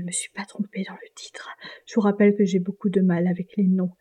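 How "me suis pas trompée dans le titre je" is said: whisper. Real, not acted. Unsure of the book title.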